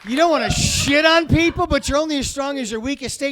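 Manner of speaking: usual speaking voice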